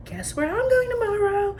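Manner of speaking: high, sing-song excited tease; excited announcement. Retold or imitated. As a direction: announce it high, building up the suspense. Excited announcement at dinner table.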